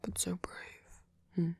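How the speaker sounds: close, fussing, affectionate comfort; tender caretaker. Acted. Caretaker soothing a poor boy who feels like garbage, tender. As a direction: comfort them with gentle teasing.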